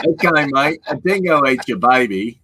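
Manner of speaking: high, loud caricature Aussie; mock Australian accent. Joining the co-host's bit, loud mock Australian accent. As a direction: shout it in a silly Australian accent. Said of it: "I'm Australian".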